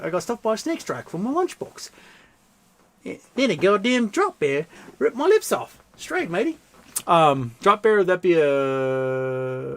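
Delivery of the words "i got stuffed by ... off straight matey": high-pitched hammed-up Aussie accent; exaggerated Australian twang, swooping high. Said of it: "read this in my Australian accent".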